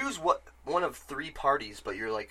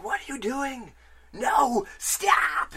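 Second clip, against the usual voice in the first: put-on young boy crying out in alarm as the oath binds him; panicked retold shout; 12 semitones higher, 6 dB louder, much slower than usual. Boy shouting at the witch to stop, high, panicked. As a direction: voice jumps high, then a strained, breathy cry of stop.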